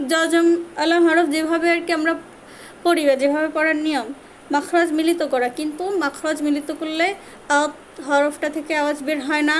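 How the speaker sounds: ordinary talk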